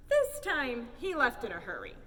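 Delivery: ordinary delivery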